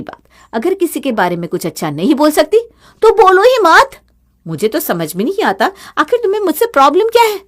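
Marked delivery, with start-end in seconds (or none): 3.0-4.0 sharp, much higher and louder; angry retort. Acted. Sister-in-law quarrel. 6.0-7.4 much higher, fast and pressing; exasperated demand. Acted. Sister-in-law quarrel.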